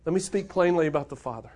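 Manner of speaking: normal talking voice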